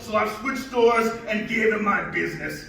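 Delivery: high Scottish brogue; thick Scottish accent, storytelling. Acted. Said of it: "his thickest Scottish brogue".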